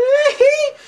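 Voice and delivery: very high, loud mock-whimpering squeal; shrill "hee-hee" squeal; high squealing laugh. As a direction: burst into a piercing falsetto giggle. Squeal capping the mock despair.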